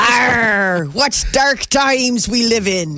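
slow hammed-up pirate growl; a hint of a pirate. Pirate joke, porch pirate.

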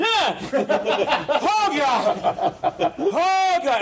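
high laughing shrieks of mock terror; terrified shriek. Professor spots the skeleton mid-lecture, hammed-up terrified shrieks.

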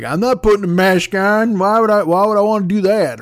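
mocking maskless customer. Customer refusing a mask to the Costco manager, mild mock defiance. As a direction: play the stubborn shopper with a bit of push. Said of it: "the dude's just like".

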